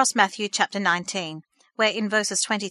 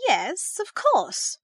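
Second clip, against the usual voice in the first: stammering; 7 semitones higher, much slower than usual. A narrator's reading. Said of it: "stammered her mother".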